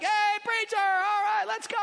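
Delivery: high, loud, rowdy greeting; lively shop owner. Retold or imitated. Beth hollering a welcome to the preacher, high and loud. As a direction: play her as a wild, cheerful hostess.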